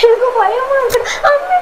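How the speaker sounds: scared tears breaking the words; real frightened crying. Real, not acted. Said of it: "நீ எதுக்கு அழுதுட்டு இருக்கிற?". (பயந்து அழும் குரல்)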